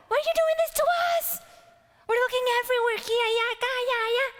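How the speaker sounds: shrill, fast, loud scolding mother; Mary as a frantic Mexican mom scolding young Jesus. Acted. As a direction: rattle it off like a panicked mom who lost her kid. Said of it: "I picture her doing that".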